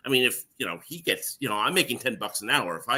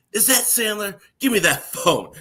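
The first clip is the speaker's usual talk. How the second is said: mock demanding; 12 semitones higher, 5 dB louder than usual. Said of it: "And Chris Rock goes".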